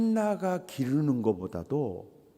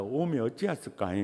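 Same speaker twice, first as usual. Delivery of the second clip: Jeolla dialect; 3 semitones lower, 6 dB quieter, faster than usual. Shown as a demonstration. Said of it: "따라서 합시다".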